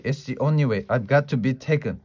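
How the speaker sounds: ordinary delivery, nothing marked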